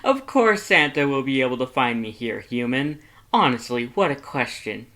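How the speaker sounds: loud, brash and cocky; boisterous Papyrus. Acted. Papyrus assuring a human friend about Santa, loudly boastful. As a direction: brag down the phone, full volume. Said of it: "Papyrus's excited phone conversation".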